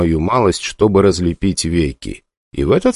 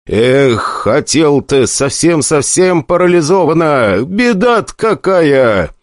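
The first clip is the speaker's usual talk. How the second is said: mock pity; 7 semitones higher, slower than usual. Mock pity over paralysed patient.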